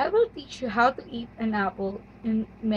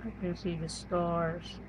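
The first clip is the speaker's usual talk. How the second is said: emo; 5 semitones lower, 8 dB quieter than usual. Pretend. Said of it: "The emo".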